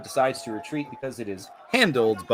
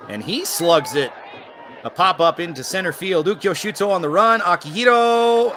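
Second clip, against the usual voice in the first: rising fly ball call; 6 semitones higher, 4 dB louder than usual. Real, not acted.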